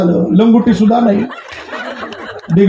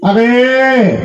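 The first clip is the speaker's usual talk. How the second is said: drawn-out hail to a wife; calling out; 2 semitones higher, much slower than usual. Acted. Husband at the back door hails his wife, calling out. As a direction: call through a door, stretching the last vowel. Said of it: "मग आवाज दिला".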